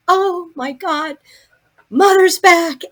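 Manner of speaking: high, loud, thrilled announcement; imitating an astonished, elated daughter; overjoyed cry. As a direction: call out the amazing news to everyone. Daughter's joyful cry at waking.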